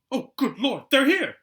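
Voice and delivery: high, loud, panicked exclaim; frantic. A narrator's reading. Aunt startled by the doorbell, high, loud, frantic. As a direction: blurt it out in a sudden flap. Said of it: "said Aunt Petunia frantically".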